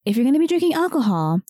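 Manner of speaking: ordinary talk, nothing marked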